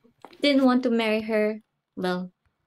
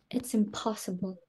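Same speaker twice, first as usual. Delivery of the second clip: a sigh, then a much softer "it's impossible"; defeated sigh; 3 semitones lower, 10 dB quieter than usual. Acted. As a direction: sigh and give up, very soft. Defeated by seating plan.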